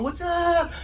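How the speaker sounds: high, drawn-out street greeting; mock gang holler; gangbanger call. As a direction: call it across the road like a gangbanger. Gang members calling across road.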